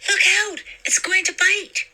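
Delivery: high, loud, frightened yell; terrified warning. A narrator's reading. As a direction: cry out a warning in terror.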